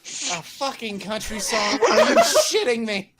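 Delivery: real laughter breaking through the words; high, loud, incredulous outburst; laughing disbelief. Real, not acted. Game master cracks up at the song.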